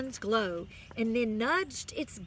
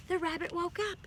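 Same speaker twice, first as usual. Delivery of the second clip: bright reveal; 7 semitones higher, faster than usual. Acted. Same words repeated brightly.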